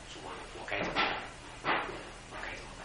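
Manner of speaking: low, soft pleading prayer; quiet prayer. Retold or imitated. Pastor quietly praying what to do. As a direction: murmur to God, almost whispering.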